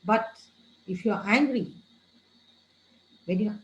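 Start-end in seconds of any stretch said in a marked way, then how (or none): none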